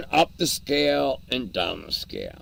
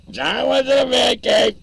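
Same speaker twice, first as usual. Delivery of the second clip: loud call across the house; 11 semitones higher, 7 dB louder than usual. Real, not acted. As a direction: shout it toward the basement. Calling out to John.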